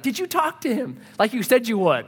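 high-pitched imitation of a church lady; mock nagging lady voice. Imagined church lady checking up on him.

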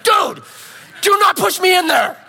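loud, high panicked plea; a frightened shout at the crowd. Retold or imitated. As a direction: yell at them not to shove you in. Jostled near the snake pit.

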